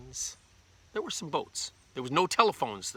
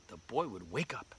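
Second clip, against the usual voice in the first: usual speaking voice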